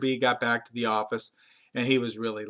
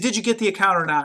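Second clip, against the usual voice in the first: angry demand; 6 semitones higher, faster than usual. Retold or imitated. Angry boss demands answer.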